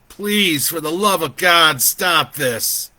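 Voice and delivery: desperate loud begging, high then falling; anguished, high-pitched shout; crying out. A narrator's reading. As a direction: plead at full voice. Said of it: "he cried".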